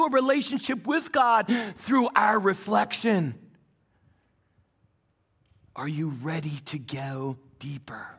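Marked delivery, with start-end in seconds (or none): 5.8-8.1 soft question. Real, not acted. Calls congregation after a pause.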